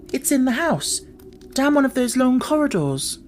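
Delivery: put-on the girl insists the crying is inside; Mary's voice. A narrator's reading.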